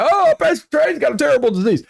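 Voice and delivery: high, fast, panicky gossip voice; mock freaked-out churchgoer. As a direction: play a churchgoer gasping over the rumour. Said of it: "I don't want anybody freaking out".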